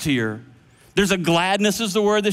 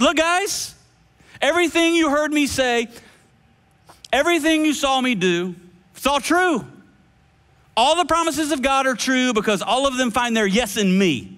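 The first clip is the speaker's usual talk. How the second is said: higher, urgent, earnest appeal to friends; eager pleading Jesus; 7 semitones higher than usual. Acted. Jesus urging his disciples that every promise is true, eager and pleading. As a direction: make them believe it's all true. Preacher acts out Jesus speaking.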